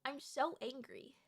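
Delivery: hushed, drained mumble after ranting; very quiet seething. Real, not acted. Worn out after the Nevada rant, very quiet. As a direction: say it tiny and low.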